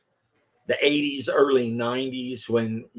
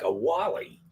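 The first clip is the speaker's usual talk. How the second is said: puzzled question; 2 semitones higher, 6 dB quieter than usual. Retold or imitated. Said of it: "And I'd be like".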